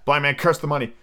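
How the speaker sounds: plain reading voice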